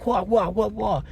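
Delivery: high, silly kung fu yelps; mock karate cries. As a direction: ham up a fake karate yell. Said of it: "he starts going".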